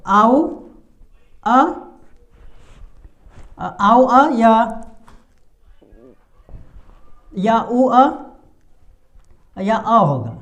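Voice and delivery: "आओ", "अ", "ऊ" isolated with long pauses between; sounding out vowels. Shown as a demonstration. Teacher lists possible readings of OUR, vowels sounded out separately. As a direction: model "आओ अ", "ऊ अ", "अ" one by one, unhurried. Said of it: "तो उसका उच्चारण".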